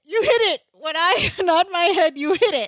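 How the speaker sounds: high insistent mock order; exasperated, high-pitched retelling with a laugh; mock screaming. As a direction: imitate barking a repeated instruction. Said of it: "He's screaming at him".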